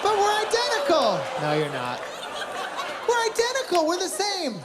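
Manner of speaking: mock insistent young woman; whiny twin protest. Twins voiced insisting to husband.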